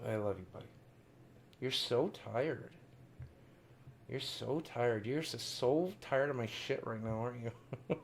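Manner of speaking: very soft, low murmur to a pet; tender aside. Real, not acted. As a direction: talk lovingly to your sleepy dog, hushed. Tearful aside to his sleeping dog.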